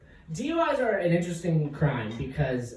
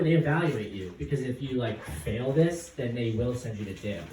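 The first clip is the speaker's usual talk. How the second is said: usual speaking voice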